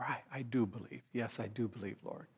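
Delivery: hushed, low prayer; praying. Retold or imitated. Father praying to the Lord for help, hushed prayer. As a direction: drop right down into a soft, humble plea. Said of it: "And then he prays".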